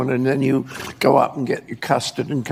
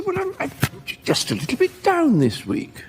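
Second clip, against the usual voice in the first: mock surprised mother; 11 semitones higher than usual. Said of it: "with a sort of sense of surprise".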